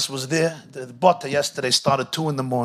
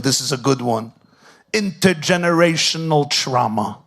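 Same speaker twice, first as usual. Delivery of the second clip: ordinary delivery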